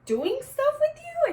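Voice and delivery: high, slow disbelieving question; incredulous. Retold or imitated. Bottle girl realizes what the customer means, high and slow in disbelief. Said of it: "You mean, like".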